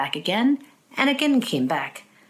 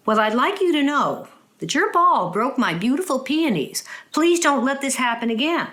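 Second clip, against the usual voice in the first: stern scolding; 7 semitones higher than usual. Acted. Scolding the neighbour boy.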